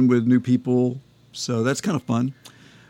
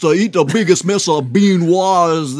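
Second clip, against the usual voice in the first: high, loud, hammed-up Louisiana judge; Louisiana accent; 5 semitones higher, 5 dB louder than usual. Co-host plays skit judge handing down a sentence, Louisiana accent. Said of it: "they all do this Louisiana accent".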